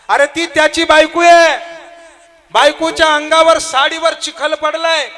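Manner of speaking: high, loud impassioned preaching; preaching at full force. Acted. Preacher answers the varkari's question, loud and high. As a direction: hammer the point home to the crowd.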